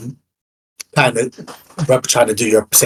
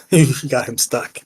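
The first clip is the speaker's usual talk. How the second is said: usual speaking voice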